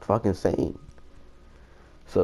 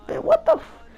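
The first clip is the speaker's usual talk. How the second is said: much higher, squeaky incredulous outburst; shocked disbelief. Retold or imitated. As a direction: act grossed-out shock, voice jumping way up. Disgusted at friend licking joint.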